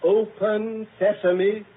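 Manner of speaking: very slow command. Acted. Password tries, slower.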